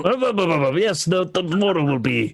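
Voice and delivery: high-pitched, stumbling interjection; flustered stammer. Acted. Answering Iggy about the mortar, flustered stammer. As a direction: stutter before getting the answer out.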